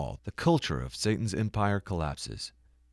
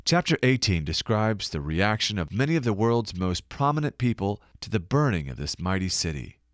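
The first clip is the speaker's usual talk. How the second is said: ordinary delivery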